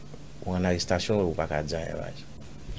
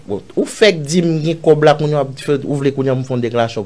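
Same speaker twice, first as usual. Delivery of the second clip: retold indignant objection; 6 semitones higher, 8 dB louder than usual. Man retelling his objection to the officer, indignant. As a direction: spell out the contradiction with raised voice.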